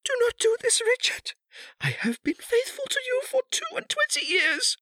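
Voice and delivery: very high, loud, tearful plea; anguished begging. A narrator's reading. Kneeling wife begs her husband, anguished and tearful. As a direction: beg desperately in a high crying voice.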